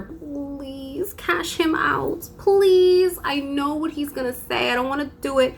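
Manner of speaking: high, drawn-out begging; pleading. Retold or imitated. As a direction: beg the coworker to take him over. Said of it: "I would have to beg".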